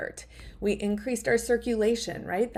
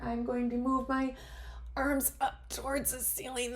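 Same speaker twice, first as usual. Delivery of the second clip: voice off-mic; 3 semitones higher, 5 dB quieter than usual. Real, not acted. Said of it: "You might hear my voice go away".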